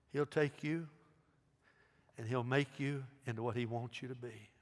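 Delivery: normal talking voice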